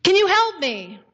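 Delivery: high-pitched plea for help; hanging man's anxious, high question; man in peril. Acted. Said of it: "So then he says to him".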